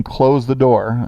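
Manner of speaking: slow, even "Close the door." spoken as a request. Shown as a demonstration. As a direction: ask them to close the door, slowly. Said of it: "I'm saying".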